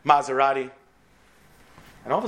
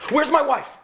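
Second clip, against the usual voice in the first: high, loud plea; frightened cry; 12 semitones higher, 3 dB louder than usual. Acted. Injured husband asking the nurse, high and loud. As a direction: call out in fear for her. Patient panicking.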